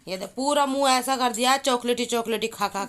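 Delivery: loud, high, playful telling-off; teasing scold. Real, not acted. Mother loudly shaming her chocolate-smeared son to viewers. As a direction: show everyone the mess he made, half laughing at him.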